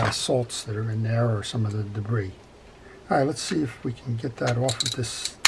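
normal talking voice

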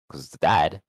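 ordinary delivery

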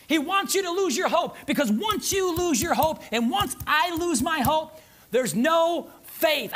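a slowed, pressing sermon line; slow emphatic preaching. Acted. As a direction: drag out each phrase, slowing to a crawl on faith.

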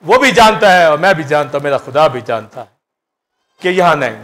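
ordinary delivery

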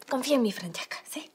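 employee whispers reassurance to the owner. Real, not acted. Pleading to the boss.

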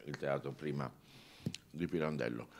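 usual speaking voice

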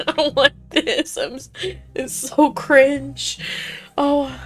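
giggling through the discomfort; amused, halting protest; laughing. Real, not acted. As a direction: laugh while cringing, stretching the end. Laughing at cringey character intro.

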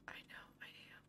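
breathy hushed whisper. Real, not acted. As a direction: drop to a whisper. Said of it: "Why am I whispering?".

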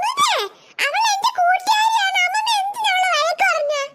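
high-pitched, shouted defence of a friend; indignant protest. Acted. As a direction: confront the grown-up hotly. Child scolds grandmother for her friend.

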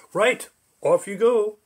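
high, loud, cheerful send-off; cheery call. Acted. Bridgekeeper sends the rabbit across, high loud and cheerful. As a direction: call it out brightly, waving him across.

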